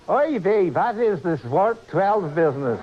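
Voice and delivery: hammed-up Yiddish-accented haggler. Engineer offers captain a wholesale deal, Yiddish accent. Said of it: "they picked a different accent".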